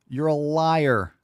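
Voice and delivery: slow, deliberate accusation; slow accusing. Real, not acted. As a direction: drag out each word. Repeated accusation, drawn out.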